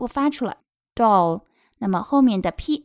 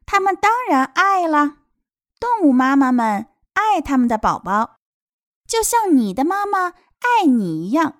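high, animated storytime delivery; storybook translation reading; 7 semitones higher, 5 dB louder than usual. Acted. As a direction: tell little ones with happy certainty. Reads translation to children. (高昂、有感情地给孩子朗读)

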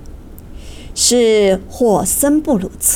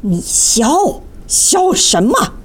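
indignant, rising higher on the second question; angry rebuke; 3 semitones higher, slower than usual. Acted. Magician scolds the laughing Seibel, indignant and sharp. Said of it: "大魔法师怒斥道".

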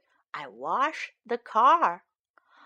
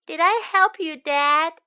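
put-on hopeful child; 6 semitones higher, 6 dB louder than usual. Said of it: "I ask".

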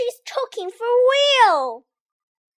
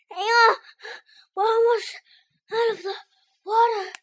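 usual speaking voice

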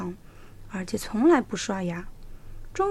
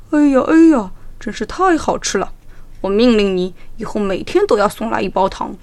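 greedy tiger; 8 dB louder than usual. A narrator's reading. Tiger tastes candy.

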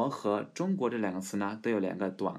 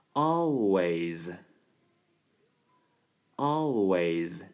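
always stretched very slowly; slow demo; 4 dB louder, much slower than usual. Shown as a demonstration. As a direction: draw out always, tongue up on the L. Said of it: "我们读作".

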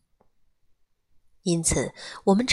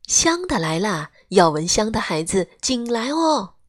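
loud, high playful hawker's cry; teasing call; 4 semitones higher, 5 dB louder than usual. A narrator's reading. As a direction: tease the kids from afar. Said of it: "有的挑水肥的人喜欢捉弄孩子".

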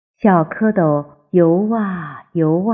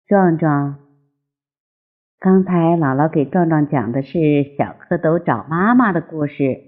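usual speaking voice

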